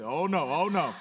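imitating a friend's laughing "oh no"; mock dismay dissolving into laughs; laughing protest. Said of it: "Oh, you said".

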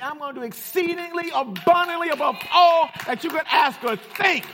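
high, shouted preacher's delivery; full-force preaching. Real, not acted. Preacher rousing church about God's new thing, full-force preaching. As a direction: drive it home at the top of your voice, punching key words.

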